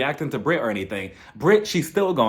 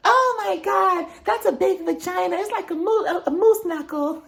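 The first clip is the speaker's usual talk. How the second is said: very high squealing impression; Portia. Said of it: "Here goes Portia".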